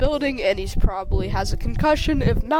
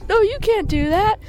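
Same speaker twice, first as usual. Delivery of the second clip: much higher, indignant objection; high-pitched protest; 11 semitones higher, 4 dB louder than usual. Acted. One character forbids the mayor's town grab, indignant. Acted protest in told story.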